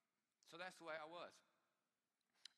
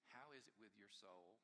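quiet question; 4 semitones lower, 8 dB quieter than usual. Shown as a demonstration. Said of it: "the question that was asked".

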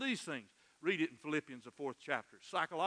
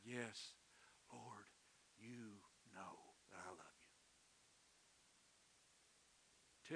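very soft, low, halting reply; hushed confession; 7 semitones lower, 23 dB quieter, slower than usual. Acted. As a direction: confess it barely audibly, phrase by phrase. Acts Peter answering Jesus in prayer.

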